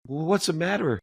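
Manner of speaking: high, pressing motherly question; a mother urging her son to say what's wrong; urgent mom. Retold or imitated. Mother pressing her weeping son, high and urgent. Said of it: "And my mom's like".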